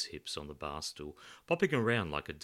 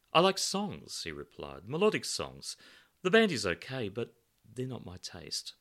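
a hint of a prim angel; lifted, fussy young man; light character voice; 6 semitones higher, 6 dB louder than usual. Guy voiced in story.

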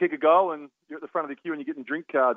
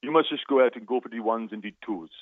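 imitated West Indian cricket man's accent. Psychologist with cricket background advising player, West Indian accent. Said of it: "trying to do my West Indian accent".

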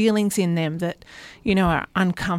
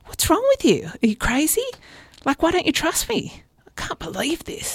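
defensive accusing; 9 semitones higher than usual. Retold or imitated. Defensive partner snapping at an anxious partner, mock outrage. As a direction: pitch jumping up high, incredulous and accusing. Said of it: "if you go down the path of saying".

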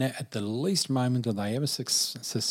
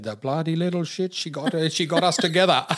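higher, louder affectionate exclamation, half laughing; fond mock outburst; 6 semitones higher, 7 dB louder than usual. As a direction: belt it out fondly, like a proud friend. Retells Tony's fond outburst.

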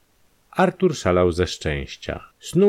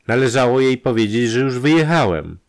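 higher, louder aggrieved complaint; reproachful; 6 semitones higher, 5 dB louder than usual. Acted. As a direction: complain with open resentment. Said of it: "powiedział z pretensją".

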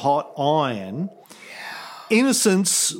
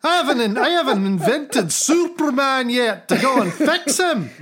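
put-on high, exasperated rant in Scottish accent; Scottish God; 8 semitones higher than usual. God griping about endless miracle requests, exasperated Scottish accent. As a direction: complain like an overworked, put-upon Scotsman. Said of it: "He must be Scottish".

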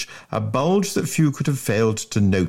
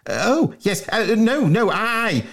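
flustered schoolboy; 12 semitones higher, slower than usual. Acted. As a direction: panic and backtrack. Bunter caught out.

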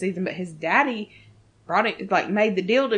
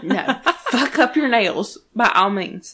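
talking through laughter; laughing; 5 dB louder than usual. Real, not acted. Friend laughing while teasing about the nails. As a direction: giggle the words out.

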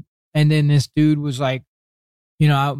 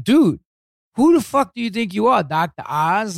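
mock scoffing; 4 semitones higher than usual. Business partner mocking the title.

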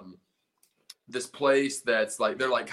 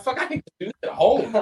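incredulous scoff; 9 semitones higher, 6 dB louder, faster than usual. Retold or imitated. Mocking the bring-your-own-liquor bar.

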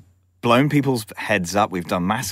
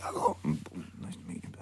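imitating a hushed, awestruck greeting; starstruck whisper; 5 semitones lower, 16 dB quieter than usual. Shop retailer meeting a film star, imitated in a starstruck whisper. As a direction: whisper it, barely any voice.

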